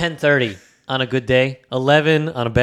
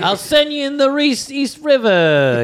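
slow, higher New York mobster threat; mafia pigeon; 9 semitones higher, slower than usual. Pretend. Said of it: "that's what pigeons say".

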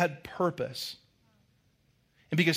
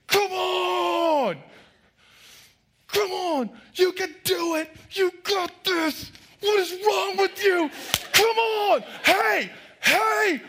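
much higher, louder sideline shout; yelling at the coach; 4 dB louder, slower than usual. Retold or imitated. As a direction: roar from the sidelines at a soccer game. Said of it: "I'm yelling at the other coach".

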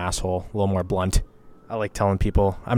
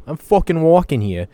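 loud, higher New York tough guy; New York accent; 8 semitones higher, 8 dB louder than usual. Retold or imitated. Showing off his New York accent, loud and brash. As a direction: bark it like a Brooklyn cabbie. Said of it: "I learned the accent a little bit".

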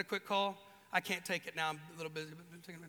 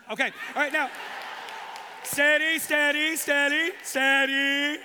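loud, higher soothing call; calming the crowd; 7 semitones higher, 11 dB louder than usual. Real, not acted. Preacher calming the laughing congregation, loud and soothing. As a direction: settle the room down.

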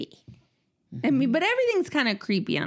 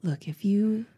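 slow, much softer, whispered mock plot; conspiratorial whisper; 5 semitones lower, 4 dB quieter, slower than usual. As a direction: whisper it like a jokey accomplice. Joking conspiracy to burn house.

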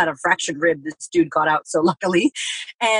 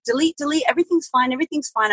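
high, sing-song mock brightness; forced cheerfulness; 8 semitones higher than usual. Pushing grief away, high mock-cheerful sing-song. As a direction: chirp it like pretending all is fine. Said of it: "So I'm like".

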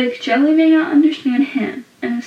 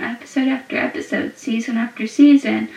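ordinary talk, nothing marked

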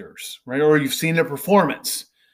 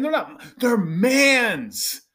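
mock ghost retort; 6 semitones higher, slower than usual. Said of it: "this ghost is saying".

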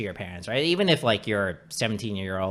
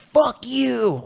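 high-pitched mock kid outburst; bratty nine-year-old kid; 6 dB louder than usual. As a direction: imitate a defiant little kid, pitched way up. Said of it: "turning around and being like".

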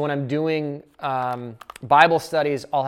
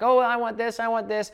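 put-on higher, childish begging; whiny toddler; 8 semitones higher than usual. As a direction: whine like a toddler wanting a cookie. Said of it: "sounds like a toddler negotiating for a cookie".

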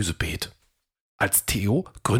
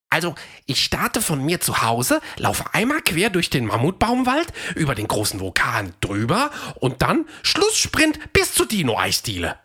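cheerful higher dino voice, eager and sporty; Fred the runner dino; 7 semitones higher than usual. A narrator's reading. Fred tells Theo about his running, proud and cheerful. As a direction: chat proudly about your race time.

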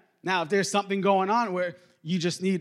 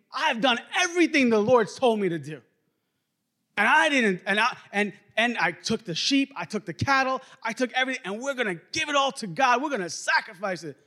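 higher-pitched, eager excuse-making; self-justifying protest; 5 semitones higher than usual. Acted. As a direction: plead your case, piling up the proof. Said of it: "'Cause he's thinking".

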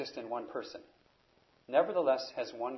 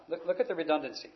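usual speaking voice

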